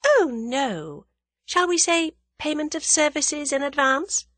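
high, sweet, smiling voice; sweetly. A narrator's reading. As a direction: correct them with mock-innocent sweetness. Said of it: "Tuppence smiled sweetly".

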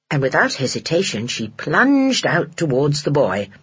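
usual speaking voice

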